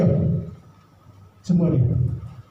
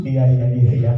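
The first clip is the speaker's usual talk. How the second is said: laughing. Acted. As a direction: chuckle out each iya. Laughing through forced smile. (tertawa sambil mengiyakan)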